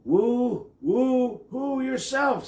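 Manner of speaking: high clear owl hoot. A narrator's reading. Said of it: "said a high, clear voice".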